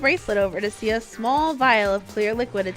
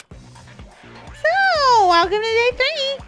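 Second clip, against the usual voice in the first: high, loud, hyped announcer voice; fighting game announcer; 14 semitones higher, 10 dB louder, much slower than usual. Acted. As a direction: boom it out like a show host. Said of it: "It was the Killing Game announcer".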